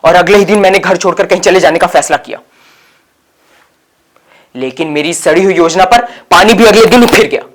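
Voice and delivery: loud, forceful storytelling; impassioned declaration. Acted. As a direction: announce the runaway plan at full voice. Dramatic telling of failed escape. (ज़ोरदार, जोशीली आवाज़ में)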